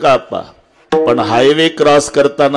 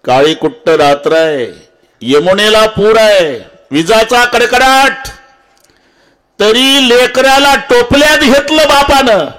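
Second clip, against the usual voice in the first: dramatic preaching; 9 semitones higher than usual. Real, not acted. Preacher telling of a father carrying his baby through floods, dramatic. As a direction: storm narration climbing to a high-pitched peak.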